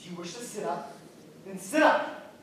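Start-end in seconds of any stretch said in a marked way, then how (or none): 1.5-2.3 angry snap. Acted. Master snaps at servant.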